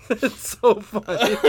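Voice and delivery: laughing through the words; breaking up with laughter. Real, not acted. Laughing at the card pull.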